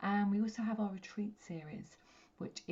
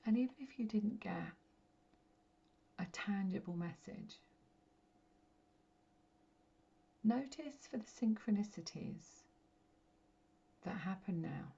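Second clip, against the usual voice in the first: guided meditation. Real, not acted. Medium guiding listeners to connect with their light, meditative. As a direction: guide them gently inward, phrase by phrase, letting each settle.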